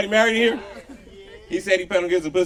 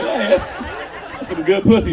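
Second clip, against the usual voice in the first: loud boast; 3 semitones higher, 6 dB louder than usual. Acted. Uncle boasting.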